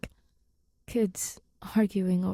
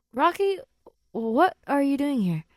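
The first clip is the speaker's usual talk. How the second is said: higher, louder startled call; startled exclamation; 7 semitones higher, 6 dB louder than usual. Acted. Grace turning around startled to find Rocky at the door, high surprised call. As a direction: jump at the sudden visitor.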